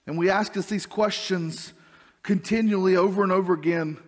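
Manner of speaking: usual speaking voice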